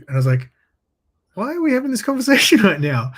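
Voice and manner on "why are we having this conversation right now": high-pitched, louder baffled question with a laugh; mock disbelief, voice jumping up; incredulous retort. Retelling baffled reply to coworker.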